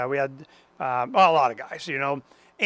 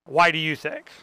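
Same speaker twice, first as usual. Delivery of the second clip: ordinary talk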